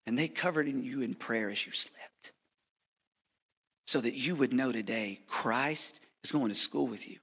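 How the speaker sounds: usual speaking voice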